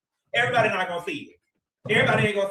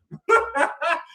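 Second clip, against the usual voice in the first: short laugh; 8 semitones higher than usual. Real, not acted. Laughing at a superchat.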